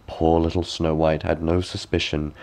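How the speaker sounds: usual speaking voice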